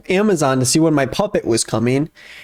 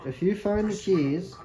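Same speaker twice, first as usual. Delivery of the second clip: subtitles read quietly through barely moving lips; ventriloquist voice; 8 dB quieter than usual. Shown as a demonstration. Reading game subtitles as a ventriloquist, soft and lips still. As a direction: read the subtitles without moving your mouth. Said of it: "read it with my ventriloquist voice".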